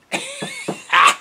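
a sudden high giggle; high-pitched laugh. Real, not acted. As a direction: burst into a high laugh at your own bad luck. Laughing at bent tailgate.

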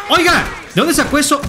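high-pitched startled challenge; startled protest. Pretend. Player to opponent who pulled something out, startled protest.

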